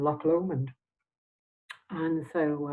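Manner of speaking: ordinary talk, nothing marked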